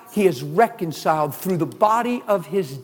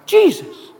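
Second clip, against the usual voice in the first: much higher and louder, rising fervor; excited preaching; 12 semitones higher, 9 dB louder than usual. Real, not acted.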